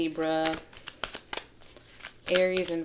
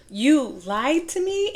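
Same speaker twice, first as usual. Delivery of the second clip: high, slow, drawn-out hurt accusation; hammed-up betrayed whine; mock Mushu wail; 8 semitones higher, 4 dB louder, slower than usual. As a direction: wail it like a wounded cartoon sidekick. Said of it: "they feel, again".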